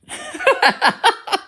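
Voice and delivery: loud, high-pitched giggling fit; high burst of laughter. Real, not acted. Streamer amused by their own audition joke, high loud laughing. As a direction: crack up in shrill, rapid laughs.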